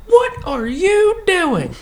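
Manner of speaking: very high, slow, drawn-out; high startled lady voice. Retold or imitated. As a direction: call out shocked in a high voice. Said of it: "she opens the door and goes".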